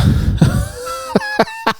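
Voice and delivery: very high, wheezy squeal of laughter. Real, not acted. Cracking up at his own joke, high squealing laugh.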